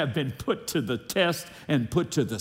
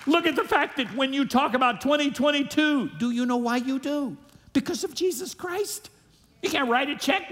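rising far higher to the name of Jesus; preaching climax; 10 semitones higher than usual. Real, not acted. Preacher telling the church why they count the years, climbing in pitch. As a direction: build to a triumphant peak.

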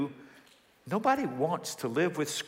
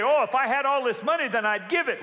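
higher, louder mock boast; mock big talker; 9 semitones higher, 4 dB louder than usual. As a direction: parody someone making a grand promise. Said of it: "You say".

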